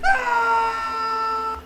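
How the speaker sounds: very high wailing scream, crying and shouting; a better, longer horror scream with a sob in it. Retold or imitated. Vlogger redoing the lady's scream as a better one, wailing cry scream. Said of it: "I got a better scream for this".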